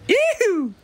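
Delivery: high, bright sing-song yoo-hoo; yoo-hoo call. Real, not acted. Caller signs off to the podcast boys with a high whooping yoo-hoo. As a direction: whoop it out way up high like a cheerful holler. Cheery sign-off call to the hosts.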